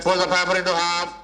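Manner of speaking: bossy, authoritative command; the mistake, an instruction shouted at the audience. Shown as a demonstration. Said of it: "Don't raise your voice".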